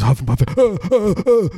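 high mock huffing and moaning; hammed-up straining grunts; imitated moaning. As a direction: huff and moan in exaggerated effort.

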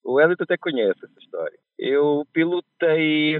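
ordinary talk

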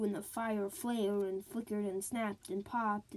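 plain reading voice

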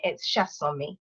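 Chasson-mi with Nigerian stress, shah-SON-mee; Nigerian pronunciation. Shown as a demonstration. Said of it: "the Nigerian, um, accent on it".

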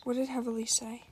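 hushed, secretive question; soft whispered query. Real, not acted. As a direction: ask it under your breath. Furtive question about a vote.

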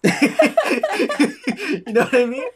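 loud, slow laughing high. Real, not acted. Laughing at his own Mary Poppins joke, laughing. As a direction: laugh through the words.

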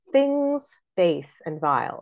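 slow line, "Things" stressed hard and held; opening trochee. Shown as a demonstration. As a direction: lean on "Things" before "base". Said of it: "beginning of that sentence a trochee".

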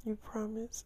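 very soft, high imitated question; hushed mock-girlish plea; small pleading voice. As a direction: ask it tiny and high, seeking reassurance. Acting partner's small pleading question.

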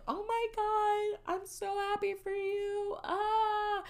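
high, thin squeal, slowly drawn out; overjoyed mom. Retold or imitated. Mom hears her daughter got her own place, mock squealing. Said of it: "my mom was immediately just like".

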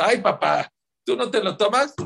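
put-on hint at a girl chiding her dad; lightly voiced daughter. Teenage daughter to father, lightly acted high challenge.

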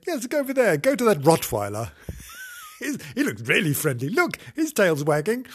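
high coaxing fake friendliness; lightly voiced sly cat; sly cruel cat. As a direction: lure him mischievously. Voicing cruel Nobs.

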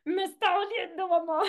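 imitated child complaining to his mother; whining child. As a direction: play the hurt little kid whining to mom. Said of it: "el niño es como".

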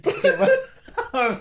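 very high laughing shriek. Real, not acted. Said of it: "se sigue riendo".

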